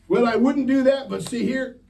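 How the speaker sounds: high, loud put-on excuse; mock excuse. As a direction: ham up someone dodging their own rule. Imitating a hypocritical listener.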